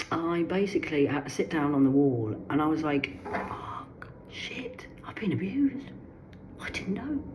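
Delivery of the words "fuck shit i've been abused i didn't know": re-enacted stunned whisper, rising high at the ends; shocked realization. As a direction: whisper it as if it is only now sinking in.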